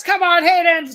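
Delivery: high, loud mock battle cry; playful battle shout. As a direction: shout it like goading a sparring buddy. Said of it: "like you and me with our battles".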